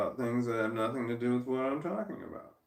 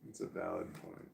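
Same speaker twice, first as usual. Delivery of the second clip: whispered under the breath; whispered aside; 12 dB quieter than usual. Real, not acted. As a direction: breathe it out, unvoiced. Muttered aside while arguing.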